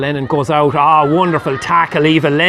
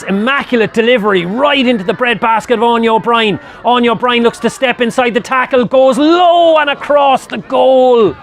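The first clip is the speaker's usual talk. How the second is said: rising excitement; 6 semitones higher than usual. Real, not acted. Commentator following an attacker shooting at goal, mounting excitement. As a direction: build urgently towards the shot, stretching the last words. Live chance on goal builds to shot.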